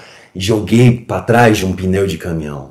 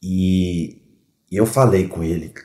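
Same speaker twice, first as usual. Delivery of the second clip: normal talking voice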